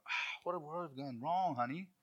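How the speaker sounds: a hint of a sorrowful praying parent, slowed; mildly mock-pious parent; pious sigh. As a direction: lament lightly to your spouse. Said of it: "And you go".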